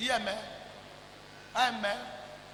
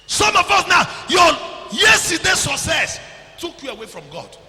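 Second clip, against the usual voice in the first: high, loud shouted preaching; loud, high sermon cry; full-force preaching; 8 semitones higher, 15 dB louder than usual. Real, not acted. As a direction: hammer the warning at the church.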